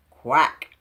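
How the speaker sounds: loud triumphant creature quack; chuckling quack. Acted. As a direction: quack it out gleefully. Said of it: "with a deep chuckle".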